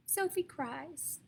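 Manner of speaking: usual speaking voice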